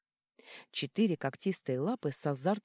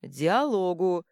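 louder, slow stamped word; loud slow bark; 2 semitones higher, 7 dB louder, much slower than usual. A narrator's reading. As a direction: pound out each syllable. Troll punching dragon. (громко и медленно)

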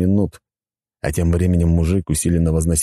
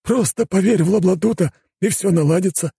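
homeless adviser. Acted. Homeless man gives his advice.

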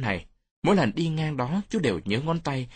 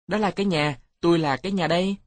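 put-on higher, playful kid voice; child pretending; 6 semitones higher, faster than usual. A narrator's reading. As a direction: play make-believe in a child's pitch. (giọng trẻ con cao, giả làm cái nhà)

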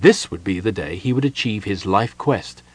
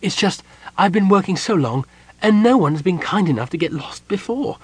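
high, tearful gnome voice; 9 semitones higher than usual. A narrator's reading. Gnome confessing to the kender, close to tears. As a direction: explain as if about to weep. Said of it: "He started to weep again".

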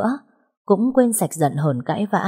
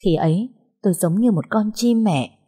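ordinary delivery

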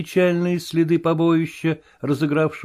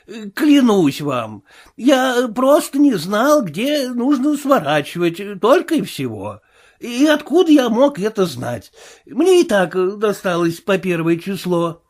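frightened tramp; 7 semitones higher than usual. Acted. Marvel excusing himself to the invisible man, higher whine. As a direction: beg like a bruised coward.

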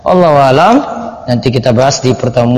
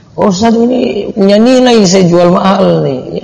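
higher-pitched imitation of a follower objecting; mock protest in a higher voice; protesting objector; 5 semitones higher, slower than usual. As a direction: put on a raised voice, pleading the case to the teacher. Voices an imagined objector to him.

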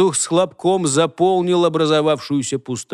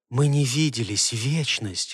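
soft, low lover's reproach; whispering; 4 semitones lower, 6 dB quieter than usual. A narrator's reading. Enchantress to Holger after an eternity apart, whispering. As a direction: murmur it softly, intimately. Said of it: "шептала она".

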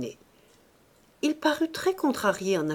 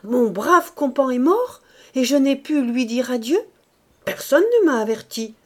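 higher, louder, aggrieved complaint; upset bishop; 4 semitones higher, 6 dB louder than usual. A narrator's reading. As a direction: vent hurt indignation.

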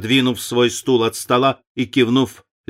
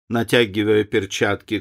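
ordinary delivery, nothing marked

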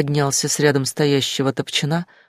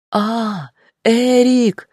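screaming; 7 semitones higher, much slower than usual. A narrator's reading. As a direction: scream his name in terror. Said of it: "закричала Дина".

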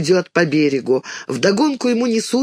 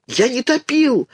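desperate cry; 6 semitones higher than usual. A narrator's reading. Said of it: "отчаянно кричит Ленька".

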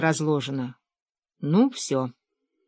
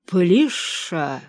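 slow, drawn-out grumble; grunting effort; much slower than usual. A narrator's reading. Father-in-law on all fours peers under cupboard, grunting. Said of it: "кряхтел".

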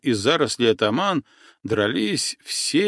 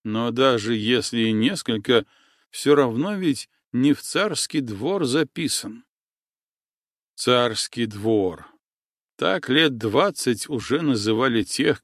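ordinary delivery, nothing marked

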